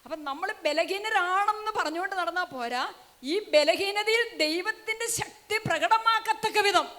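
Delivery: rising sermon cry, pressing the congregation; fiery preaching. Real, not acted. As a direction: preach it with full force. Preacher building to a climax.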